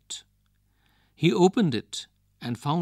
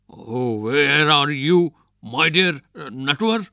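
calling out bravely; 3 semitones higher, 6 dB louder than usual. A narrator's reading. Vijay Singh calling back to the ghost, loud forced bravado. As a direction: shout into the dark, pretending not to be scared. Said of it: "Trying to sound brave, he called back".

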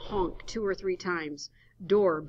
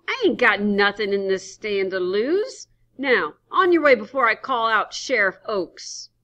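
put-on angry old man; 4 semitones higher, 12 dB louder than usual. Henry threatening.

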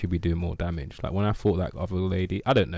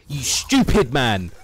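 gloating yell; 5 semitones higher, 9 dB louder, slower than usual. Real, not acted. Gloating at beaten enemy.

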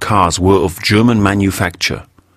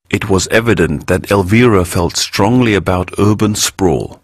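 ordinary delivery, nothing marked

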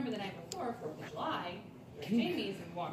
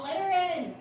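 raised voice, calling down a hall; yelling; 7 semitones higher, 6 dB louder than usual. Retold or imitated. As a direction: call it out down the hallway. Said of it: "yelled down the hall".

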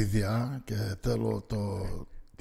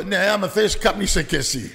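dismissive attendant; 5 semitones higher, 6 dB louder than usual. Retold or imitated. Attendant dismisses smoking complaint.

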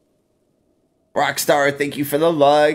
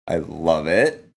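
ordinary talk, nothing marked

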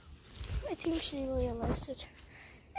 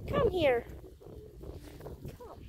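usual speaking voice

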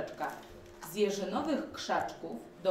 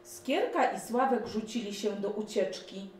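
ordinary delivery

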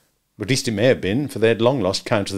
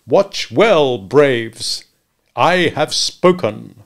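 loud commanding chief; 3 semitones higher, 7 dB louder, much slower than usual. Acted. Redskin chief commands braves.

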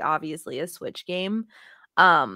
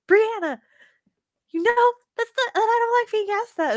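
high-pitched imitation of an exasperated sister; mock-mad sister; 13 semitones higher, 3 dB louder than usual. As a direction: scold like an annoyed sister, played for laughs. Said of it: "She gets so mad at me".

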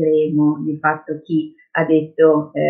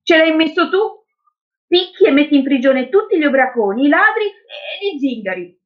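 put-on high-pitched accusing girl; accusing child; 9 semitones higher than usual. Girl blames the policeman for jailing people, accusing. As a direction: accuse him in a higher child's voice.

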